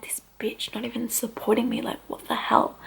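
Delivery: soft, under-the-breath retold complaint; hushed indignation. Mother in labour about her unsupportive mum, hushed mock indignation. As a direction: grumble it very quietly, as a thought.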